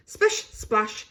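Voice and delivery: ordinary delivery, nothing marked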